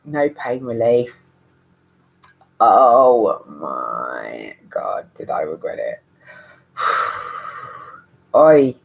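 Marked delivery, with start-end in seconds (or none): 2.6-6.0 much lower, slow, dragged-out groan of regret; deep dramatic regret. Real, not acted. Regretting natural birth. 6.8-7.8 heavy sigh. Real, not acted. After regret.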